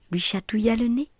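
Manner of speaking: ordinary delivery, nothing marked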